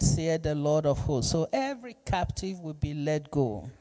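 ordinary delivery

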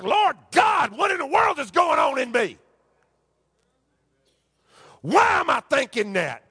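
impassioned, pleading pulpit cry; preacher acting out anguished questions to God. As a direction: cry out the questions with climbing pitch. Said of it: "crying out to me, saying".

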